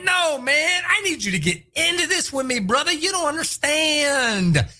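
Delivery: high, urging hype voice; mock hyped urging; pumped player. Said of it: "He's like".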